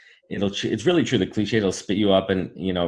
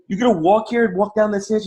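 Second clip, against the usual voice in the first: high, incredulous workman's retort; mock exasperation; 12 semitones higher, 3 dB louder than usual. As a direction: argue back in a raised pitch. Worker argues with lady.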